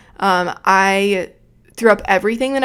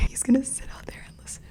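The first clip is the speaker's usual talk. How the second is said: whispering; 5 semitones higher, 10 dB quieter, faster than usual. Real, not acted. Whispering about listener outside.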